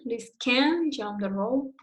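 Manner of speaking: negative "can" drawn out slowly before "jump"; stress on CAN'T. Shown as a demonstration. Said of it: "Here is the same".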